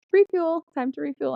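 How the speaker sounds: high, sing-song mock of the car's refuel alert; car warning voice. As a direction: chirp it like the dashboard nagging you.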